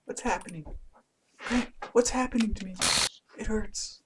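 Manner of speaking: put-on a game girl begging for help in pain; pained pleading girl.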